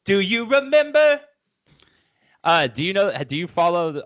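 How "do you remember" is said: high, loud mocking echo. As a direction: squeal it back at him. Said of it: "echoing the letter line".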